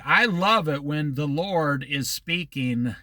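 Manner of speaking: slow, deliberate restatement; said slowly. Real, not acted. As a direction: say it slowly, letting each word land. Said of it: "I'll say that slowly".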